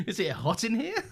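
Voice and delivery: giggling through the words; laughing. Real, not acted. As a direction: laugh while teasing. Teasing flirt joke.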